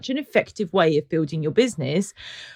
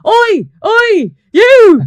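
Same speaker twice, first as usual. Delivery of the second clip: loud aggressive shout; shouting man; 13 semitones higher, 13 dB louder, much slower than usual. Retold or imitated. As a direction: bellow it across the road. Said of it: "the guy was shouting across the road".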